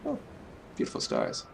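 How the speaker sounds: breathless hushed wonder at the sky; soft whispered awe; awed whisper. Real, not acted. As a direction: whisper it, taken by the view.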